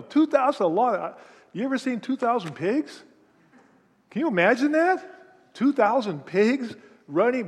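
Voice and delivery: wide-eyed wonder at two thousand pigs; can you imagine that; amazed. Real, not acted.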